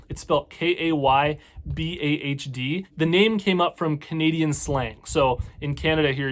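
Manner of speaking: ordinary talk, nothing marked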